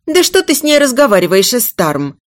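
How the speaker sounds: high, quick, irritated outburst; sharp exasperated reproach; angry cry. Acted. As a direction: snap at him in anger. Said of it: "зло выкрикнула Ия".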